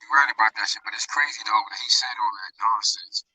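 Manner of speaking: hollow, muffled phone line with cave echo; in a hole. Real, not acted. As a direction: voice echoing like down a well. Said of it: "sound like you're in a hole".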